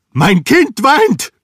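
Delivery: high, loud, alarmed cry; schreien. A narrator's reading. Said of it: "schrie er". (laut und hoch aufschreien)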